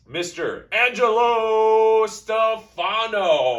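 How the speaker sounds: high, slow drawn-out name call; ring announcer. Acted. Introducing cohost ring style.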